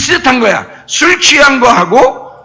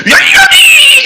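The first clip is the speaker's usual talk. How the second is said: very high, loud crying shout; fervent cry; 5 dB louder, much slower than usual. Shown as a demonstration. Preacher showing real fervour, high crying shout. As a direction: scream it with everything. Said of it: "더 흔들어야 돼".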